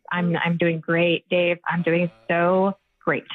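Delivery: slow, low, pointedly sarcastic. Real, not acted. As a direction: drag out the fake cheer with heavy irony. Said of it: "I was being sarcastic".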